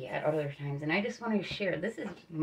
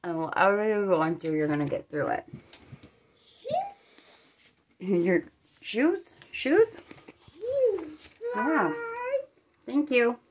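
fingers at mouth; adjusting denture while talking; new upper denture in; 7 semitones higher, 6 dB louder than usual. Real, not acted. Seen 0: Presses freshly inserted upper denture with fingers, then speaks with it.

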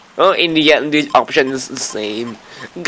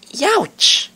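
much higher startled cartoon yelp; squeaky higher-pitched youch; character yelp. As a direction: yelp like a little Pokemon in pain. Reading game dialogue in voices.

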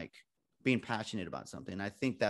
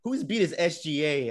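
loud, higher, drawn-out wisecrack; class clown; 5 semitones higher, 6 dB louder than usual. Retold or imitated. Student clowning in a newsroom meeting, cheeky and loud. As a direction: call it out slowly like the class clown. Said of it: "the class clown that I am".

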